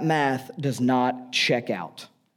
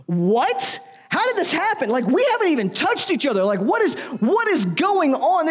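much higher, squeaky shocked outburst; shocked disbelief; 11 semitones higher than usual. Retold or imitated. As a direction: gasp it out in panicked disbelief. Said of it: "How do you think Joseph responds".

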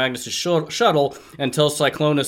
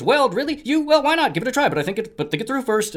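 cheery robot voice; 11 semitones higher, faster than usual. Acted. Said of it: "And Blur says".

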